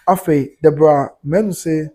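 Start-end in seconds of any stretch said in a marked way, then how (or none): none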